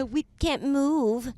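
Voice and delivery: high, slow mock whine; mocking whiny voice. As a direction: whine like a scared softie. Mocking other travellers' complaints.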